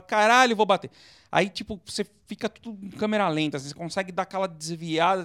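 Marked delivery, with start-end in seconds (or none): 0.0-0.9 mock alarm. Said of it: "assim, você fala".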